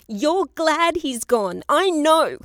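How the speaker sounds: loud, high, drawn-out accusation; child's shout. A narrator's reading. Child accusing grandmother of being glad father left, shouting. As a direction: shout it slowly at full pitch, hurt and accusing. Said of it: "shouted that long-ago child".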